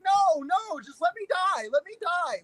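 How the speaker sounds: high, whiny mock plea to die; mock despair. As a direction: wail it like a hammed-up movie death. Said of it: "And you're like".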